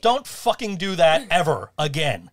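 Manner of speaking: loud, slow telling-off; annoyed scolding. Real, not acted. Partner scolds co-host for the mocking cheer, annoyed. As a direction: scold them, loud and deliberate.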